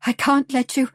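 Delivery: implore, with the pitch lifted; imploring. A narrator's reading. Lottie pleading with Ivan not to sacrifice himself, imploring. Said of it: "she whispered imploringly".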